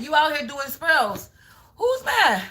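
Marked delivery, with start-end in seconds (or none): 1.8-2.4 high, swooping mock question; taunting. Real, not acted. Reader taunts her commenters over who is mad, taunting. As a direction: tease them with a leap up in pitch.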